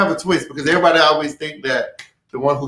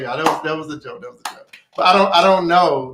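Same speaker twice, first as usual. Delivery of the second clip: ordinary talk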